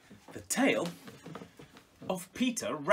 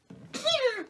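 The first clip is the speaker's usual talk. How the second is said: startled gasp; 3 dB louder than usual. Acted. Peter sneezes, gets discovered.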